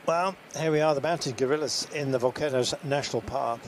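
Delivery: lightly hushed wildlife presenter; nature documentary. Acted. Nature documentary aside about gorillas.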